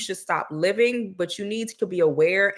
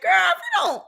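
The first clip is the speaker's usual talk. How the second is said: very high, incredulous squeal; sassy disbelief; 3 dB louder than usual. Retold or imitated. Reacting to a prophecy of dragons, squealing in sassy disbelief. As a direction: shriek your disbelief at the nonsense. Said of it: "I'm like".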